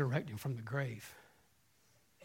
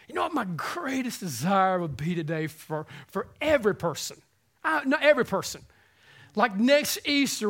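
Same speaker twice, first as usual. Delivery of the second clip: loud, rising sermon voice; a pastor building to an Easter climax; fervent preaching; 6 semitones higher, 6 dB louder than usual. Acted. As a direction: preach it out to the whole room. Preaching at full force.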